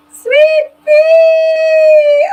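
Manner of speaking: high, loud joyful call; excited greeting. Real, not acted. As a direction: shout her name with delight.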